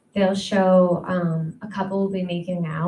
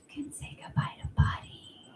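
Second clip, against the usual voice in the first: whispered hostage threat; menacing whisper; 5 semitones higher, 11 dB quieter than usual. Retold or imitated. A kidnapper whispering a threat to the viewer.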